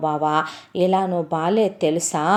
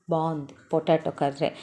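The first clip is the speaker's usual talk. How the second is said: glum reply; 3 semitones lower, 6 dB quieter than usual. Acted. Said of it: "ఏడుపు మొహం పెట్టుకొని చెప్తావు".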